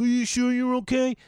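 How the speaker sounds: high, overly concerned check-in; a hint of fussy worry; mock worried pestering. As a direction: imitate a nagging worrier. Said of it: "I'm not gonna sit there and be like".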